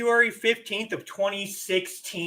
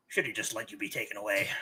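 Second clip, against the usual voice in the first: low teasing aside; 9 semitones lower, 9 dB quieter, much faster than usual. Acted.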